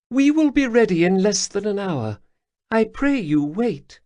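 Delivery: high, purring alien lilt; Khajiit matriarch. Acted. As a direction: speak as a grateful cat-woman elder. Lesper asks him to wait.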